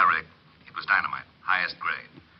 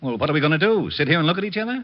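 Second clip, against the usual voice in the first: impatient; 8 semitones higher, 3 dB louder than usual. Acted. Impatient lieutenant badgering detective.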